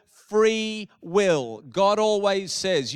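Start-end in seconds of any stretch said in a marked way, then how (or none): 0.1-1.6 "Free will" slowly stretched, each word stressed; "Free will" said firmly, word by word; emphasis on FREE WILL. Real, not acted. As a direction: underline "Free" with the voice, then "will". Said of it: "I'm emphasizing that word".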